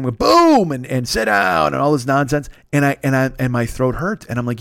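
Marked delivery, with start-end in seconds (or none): 0.2-0.7 high, booming game-stream yell. Retold or imitated. Streamer imitating himself fighting on Twitch, explosive yell. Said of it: "I'm yelling when I'm fighting". 1.1-1.7 high-pitched mock battle shout; yelling. Streamer imitating his own trash talk while fighting, yelling. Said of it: "I'm yelling when I'm fighting".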